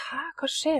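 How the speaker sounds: whispered, confused; whispered thought. Retold or imitated. Confused guest thinking to herself, whispered. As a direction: whisper it to yourself, baffled. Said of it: "Så tenkte jeg".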